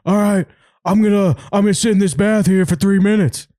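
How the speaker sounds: high-pitched impression of a determined guy on video; imitated video guy. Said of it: "He goes".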